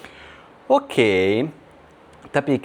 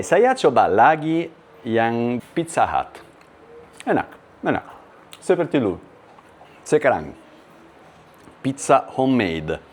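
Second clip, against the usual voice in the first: ordinary talk, nothing marked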